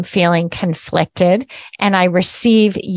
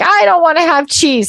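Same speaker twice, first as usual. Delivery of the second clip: whiny family member; 9 semitones higher than usual. Retold or imitated. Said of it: "everyone else is like".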